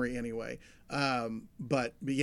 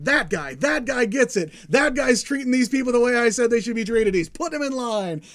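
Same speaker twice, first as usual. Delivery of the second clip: higher and louder admiration; approving enthusiasm; 8 semitones higher, 8 dB louder than usual. Retold or imitated. God admiring Sunstar, higher and louder. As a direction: point at him like you finally found your guy. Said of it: "and he's like".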